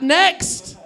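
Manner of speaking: high, loud mock call for the next one. Comedian mimicking an audience done with that joke, loud call. Repeats the crowd's impatient "next.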